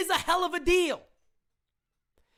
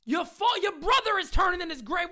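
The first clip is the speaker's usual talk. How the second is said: normal talking voice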